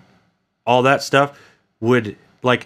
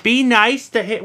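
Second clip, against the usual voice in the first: imitating a mom; 10 semitones higher than usual. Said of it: "She'll be like".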